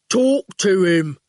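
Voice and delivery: very slow, heavy henchman voice; slow goon. A narrator's reading. The goon grinning at Howard, slow and heavy. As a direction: drag each word out flatly.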